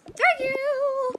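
high slow delighted thanks; excited. Pretend. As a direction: stretch it out high and happy.

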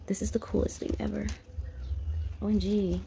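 awed hushed whisper. Real, not acted. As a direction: breathe it out in wonder. Whispers in awe inside ship.

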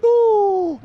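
long, loud, high "oh"; shocked cry. Real, not acted. Commentator watching Charé lose control, high shocked cry. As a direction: let out an astonished howl. Shocked reaction to player's outburst.

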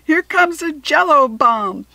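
loud, high gleeful call; playful yell across the lunchroom; battle cry. Acted. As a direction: announce the throw with glee. Gleeful food fight battle cry.

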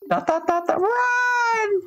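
squeaky high cry to run; high-pitched panicked voice. Acted. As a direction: shriek it high like a cartoon. Voicing a fleeing character.

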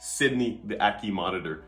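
ordinary talk, nothing marked

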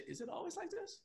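stepmom's question. Retold or imitated. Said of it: "she said".

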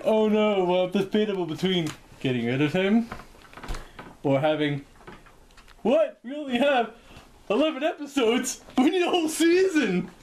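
high, loud alarmed cry; hammed-up dismay at losing the show; mock producer panic. Producers panic over episode count.

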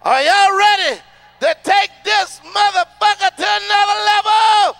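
loud, high crowd-pumping call; sung-out intro, stretched and loud; hype-man shout. Real, not acted. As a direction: fire up the crowd. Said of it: "When I say, "Ricky," you say".

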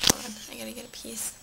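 quick whisper under the breath; hushed quick aside while grabbing food. Real, not acted. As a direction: murmur it fast to yourself. Aside while fetching ham.

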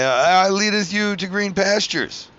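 high, slow biblical mock tone; mock preacher. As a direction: intone it like a hammed-up psalm. Mock psalm quote.

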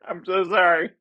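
laughing apology. Real, not acted. Laughs at stale chewy candy.

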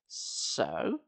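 low, soft, blunt so; demanding. Acted. Gabe presses Sari, low and curt. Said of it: "I demanded".

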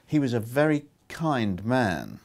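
plain reading voice

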